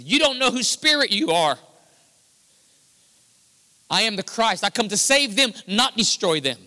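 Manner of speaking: Jesus setting them straight, voice lifted; firm rebuke. Retold or imitated. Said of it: "And Jesus said".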